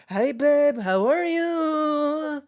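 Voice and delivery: high, drawn-out sing-song imitation of the scammer; mocking scammer voice. Mocking the scammer's phone greeting, high and slow. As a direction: stretch it out like a cheesy romance scammer. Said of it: "he might as well have that accent".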